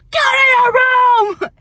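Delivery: very high, loud, slow shrieking order; hammed-up screaming mother; mock shout. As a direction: scream it like the furious mom. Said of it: "She'd be like".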